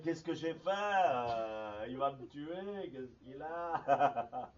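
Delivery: mock-frightened, drawn-out words; retold panic. Disciple fearing the master will kill him, retold panic. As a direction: replay your own fright, half amused, stretching the endings. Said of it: "j'ai été pris de peur".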